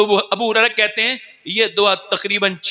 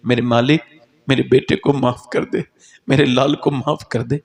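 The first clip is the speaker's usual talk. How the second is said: tender plea; 8 semitones lower than usual. Acted. Mother pleading with God to forgive her son, low and tender. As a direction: beg gently for her child.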